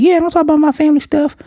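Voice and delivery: put-on girl brushing off talk of her family, squeaky and quick. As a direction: chirp it out like a tiny kid. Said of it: "And she's like".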